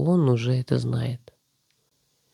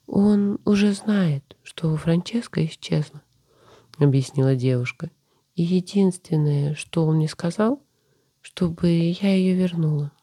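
tearful; 4 semitones higher than usual. Acted. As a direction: hold back sobs while explaining. Tearful sister explains, then sobs.

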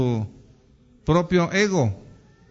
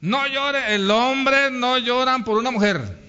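mock tough youths; 6 semitones higher than usual. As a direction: imitate young men, pitched up. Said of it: "Y luego dicen cuando son jóvenes". (jóvenes machitos, en tono agudo y burlón)

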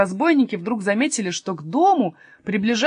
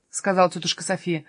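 plain reading voice

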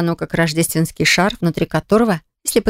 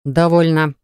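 cold icy voice; slower than usual. A narrator's reading. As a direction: cut her off coldly. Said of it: "произнёс император ледяным голосом".

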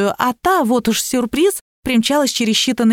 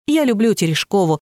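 plain reading voice

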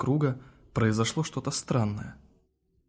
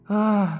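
loud high scream; 9 semitones higher, 5 dB louder than usual. A narrator's reading. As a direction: shriek in alarm. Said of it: "закричала в ответ Горгония".

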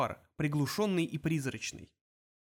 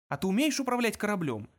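higher-pitched courteous question; polite inquiry; 5 semitones higher than usual. A narrator's reading. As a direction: ask with mild politeness. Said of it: "вежливо поинтересовался Форд".

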